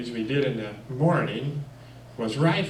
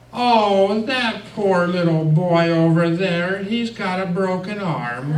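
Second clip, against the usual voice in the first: imitating a teacher's higher, louder, put-on pity; mock sympathy; 7 semitones higher, 8 dB louder, slower than usual. Teacher feigning pity to the other teacher, higher and louder. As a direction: ham up fake concern for a poor boy. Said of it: "she looked over there at that teacher and said".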